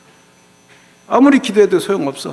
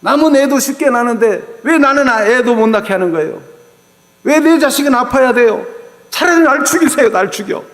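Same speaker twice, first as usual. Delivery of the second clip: bitter accusation; 6 semitones higher than usual. Acted. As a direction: throw the blame at him until you break. Wife confronting husband over childlessness.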